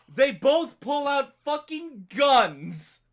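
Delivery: slow, loud and high for the punchline; dramatic shout. Real, not acted. Telling friends the plot twist, slow loud shout. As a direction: build to a shouted reveal.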